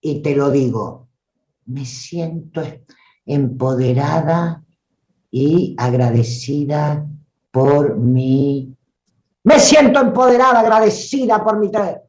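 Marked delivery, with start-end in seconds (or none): 1.7-8.8 slow, dull, held-back lows; flat covered version of me siento empoderada; muffled low voice. Shown as a demonstration. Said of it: "los bajos". 9.4-12.0 much higher, louder and rushed, shrill edge; the strident take of the same line. Shown as a demonstration. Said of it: "las estridencias".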